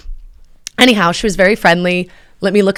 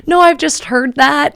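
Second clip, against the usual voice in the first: high, slow, awkwardly smiling reply; retold awkward backpedal through a smile; 8 semitones higher than usual. Diner trying to recover from her joke, awkwardly smiling. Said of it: "I'm just smiling awkwardly".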